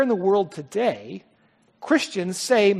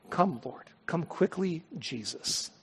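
soft prayerful plea; 4 semitones lower, 12 dB quieter, slower than usual. Real, not acted. Ending sermon with quiet plea.

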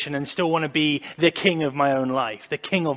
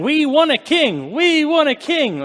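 high, loud, slow chanted demand; mock crowd chant, much higher and louder; crying-out crowd; 12 semitones higher, 9 dB louder than usual. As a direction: voice the clamoring people. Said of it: "people were crying out saying".

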